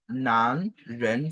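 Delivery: "nan, ren" each gliding upward; rising pair of syllables, "nan, ren"; second tone. Shown as a demonstration. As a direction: lift both "nan" and "ren" from low. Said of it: "est le deuxième ton".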